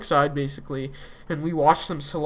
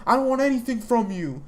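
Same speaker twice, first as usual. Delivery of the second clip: higher-pitched mock yell of a furious son; retold angry yelling; 7 semitones higher than usual. Son yelling at his father over the phone, retold angry yell. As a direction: voice the son's outburst, pitched up and heated. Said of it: "yells at Walt".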